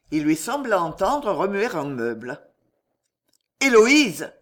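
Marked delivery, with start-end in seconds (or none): 3.6-4.3 loud, high shout of her name; crying out. A narrator's reading. Husband calls his wife through the locked door, louder cry. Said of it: "répéta-t-il".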